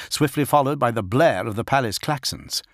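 ordinary delivery, nothing marked

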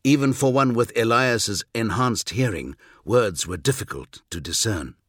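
ordinary delivery, nothing marked

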